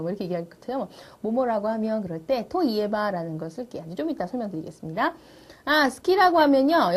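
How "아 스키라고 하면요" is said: loud eager line. Shown as a demonstration. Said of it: "teacher acts student line".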